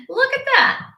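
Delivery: excited. Real, not acted. Delighted at a four ninety-nine.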